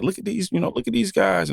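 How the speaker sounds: high mocking onlooker voice; mock gawking tourist. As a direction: imitate outsiders gawking at the zoo. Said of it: "people will come and be like".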